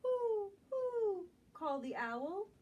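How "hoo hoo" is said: higher, louder hoots; a louder owl hoot. Acted. Owl voice in picture book.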